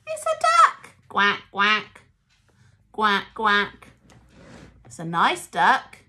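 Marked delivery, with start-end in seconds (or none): 0.1-0.9 much higher, loud delight; excited. Acted. Duck toy revealed. 1.1-3.9 lower, loud quack quack; duck quacking. Retold or imitated. Imitating the toy duck.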